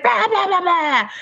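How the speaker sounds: high yappy mock dog barks; imitating the dog barking at the door; imitated barking. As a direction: bark like a furious little dachshund. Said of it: "she turns into Rambo".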